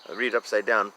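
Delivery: plain reading voice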